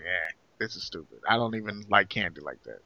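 low, quiet, unimpressed grumble; soft, low-pitched self-quote, totally uninterested; dismissive. Retold or imitated. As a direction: shrug it off under your voice. Quotes himself losing interest in Halloween.

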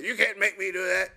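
defiant whiner. Retold or imitated. Person refusing to do the right thing, mock defiant whine. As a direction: sulk like a spoiled refuser. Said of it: "that's like".

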